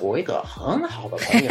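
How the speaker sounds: high, laughing mainland Mandarin intonation; Beijing accent. Shown as a demonstration. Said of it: "somewhere in the mainland, you could say".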